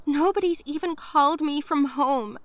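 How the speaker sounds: crying out. Retold or imitated. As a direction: cry it out in despair. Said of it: "I cried".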